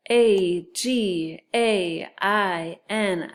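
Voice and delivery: very slow, letter by letter; spelling aloud. Shown as a demonstration. Teacher leading sight word review, spelling aloud. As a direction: spell it out with a space after each letter. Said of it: "and spell it".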